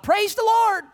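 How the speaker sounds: a high, loud joyful shout; shouted praise. Retold or imitated. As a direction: yell it out to the whole room. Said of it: "my mom yelled out".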